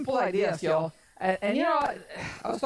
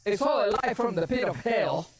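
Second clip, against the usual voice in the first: ordinary talk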